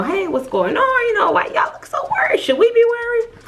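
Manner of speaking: high, anxious mother asking what's wrong; worried mom voice. Retold or imitated. Retelling her mom asking the nurses why they look worried, high and anxious.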